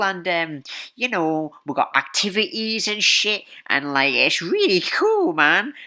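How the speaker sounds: hammed-up "one of the kids" sales pitch; recruiter acting cool. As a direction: play a try-hard recruiter pitching to teens. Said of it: "they're trying to act, like, really, like".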